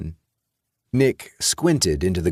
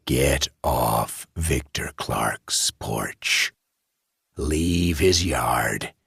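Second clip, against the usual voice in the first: menacing warning; 2 semitones lower, much slower than usual. Acted. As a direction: lean in and threaten him slowly, barely above a whisper. Man threatens the boys.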